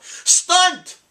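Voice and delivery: high, loud mock yell. Retelling the lady yelling the word, high and loud. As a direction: shout it across the room like her. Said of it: "she yelled".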